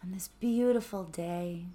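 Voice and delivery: soft, calm meditation voice, sometimes whispered; hushed gratitude meditation; guided meditation. Acted. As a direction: ease listeners inward with gentle questions.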